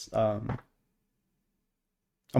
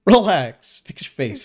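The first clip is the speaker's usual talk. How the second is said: mock stern snap; 7 semitones higher, 7 dB louder, slower than usual. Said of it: "mock scolding himself on camera".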